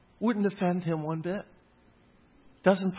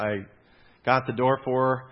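ordinary delivery

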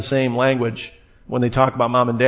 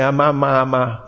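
lightly voiced baby babble; a hint of a baby trying out syllables; babbling baby. Preacher imitating a baby's first words, light babbling. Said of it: "When the little baby starts to say".

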